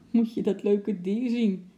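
high, delighted exclamation; mother calling out. A narrator's reading. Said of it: "riep de moeder van het kleine meisje". (verrukte uitroep van een moeder)

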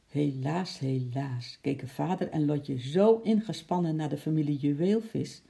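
ordinary delivery

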